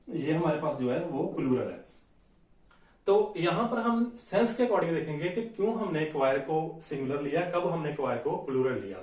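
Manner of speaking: usual speaking voice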